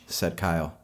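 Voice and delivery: ordinary delivery, nothing marked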